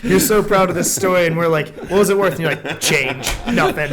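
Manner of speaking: loud, high, laughing teasing; mock him through laughter for such a small sum. Real, not acted.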